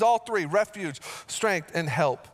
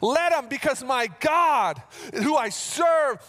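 full-force preaching; 6 semitones higher than usual. Acted. Pastor declaring to the church he will not fear, fervent preaching. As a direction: preach it with conviction.

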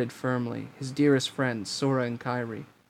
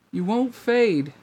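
put-on girl's voice, slow; 8 semitones higher, 3 dB louder, slower than usual. A narrator's reading. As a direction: ring out gently like a girl. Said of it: "a girl's voice rang".

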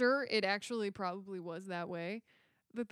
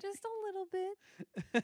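mock dainty echo; 9 semitones higher, 4 dB quieter, slower than usual. Mocking echo of co-host.

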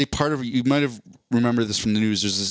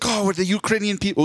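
imitating an overjoyed president, voice up high; retelling a sitcom president's delight in a high excited voice; mock excited; 8 semitones higher than usual. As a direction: gush like you just got the best news. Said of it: "And he's so excited".

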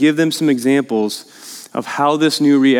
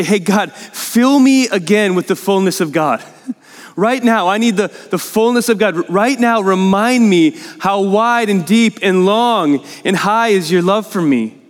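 higher-pitched, impassioned plea to God; emphatic, emotional; 4 semitones higher than usual. Acted. Preacher models a prayer to God for fullness, emphatic and emotional. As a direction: pray it urgently, pitched up. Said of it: "all that I just said so emphatically and emotionally".

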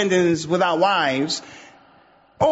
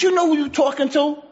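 indignant companion; 8 semitones higher than usual. Retold or imitated. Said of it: "The companion said".

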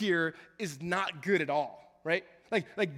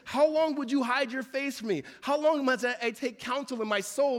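loud, higher lament; crying out; 6 semitones higher, 5 dB louder than usual. Acted. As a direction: plead How long with full force. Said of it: "David said".

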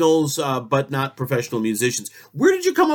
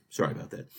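whisper; 13 semitones lower, 12 dB quieter, faster than usual. Real, not acted. Host apologizes after rambling.

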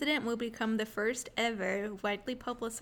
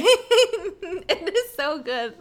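laughing; 8 semitones higher, 11 dB louder, much slower than usual. Real, not acted. Laughs while praising story.

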